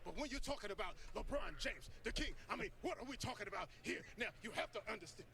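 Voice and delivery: high-pitched, emphatic sports pundit; Stephen A. Smith. Acted. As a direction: launch into an animated pundit's rant. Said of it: "How would Stephen A. Smith say it".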